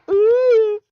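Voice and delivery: high loud hammed-up ooh; loud high camp ooh; high mock ooh.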